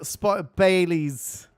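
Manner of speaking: put-on loud, slow playful English accent. Streamer offering a drink to viewers in a put-on English accent. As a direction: draw it out in a mock English accent. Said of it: "I don't know why I went English".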